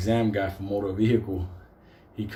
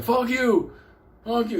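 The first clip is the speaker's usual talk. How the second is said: much higher mock-angry voice; imitated angry retort; 11 semitones higher, 3 dB louder than usual. Woman crossing yells back at the driver, mock angry. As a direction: curse back as the offended pedestrian.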